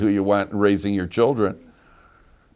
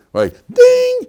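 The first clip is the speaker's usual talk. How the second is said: high bright bell ding; ping it like a bell; ding sound; 8 dB louder than usual. Retold or imitated. Mimics a bell of inspiration.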